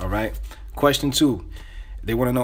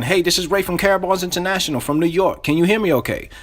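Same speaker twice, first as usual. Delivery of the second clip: upbeat, higher-pitched sales greeting; enthusiastic; 6 semitones higher, 3 dB louder than usual. Shown as a demonstration. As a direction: open the cold call with real energy. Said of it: "you gotta say this with enthusiasm".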